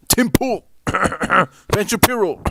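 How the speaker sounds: high, loud cough-covered jab; fake cough name-drop. Streamer slyly outing journalists as agents, fake-coughed names.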